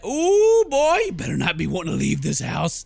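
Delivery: loud, high, thrilled alarm; excited alarm. Real, not acted. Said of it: "We done made her mad".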